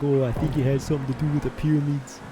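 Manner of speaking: high, loud, fast mock Australian show voice; hammed-up Australian tv host; tv show impression. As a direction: rattle it off like a tv show character. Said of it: "something from the Big Les show where it's like".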